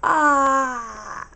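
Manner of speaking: loud open-mouthed yawn sound; big yawning ahh. Shown as a demonstration. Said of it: "No, big yawn".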